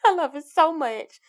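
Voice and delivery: laughing while gushing; delighted laugh. Real, not acted. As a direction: giggle through the words. Gushing laugh over graduation photos.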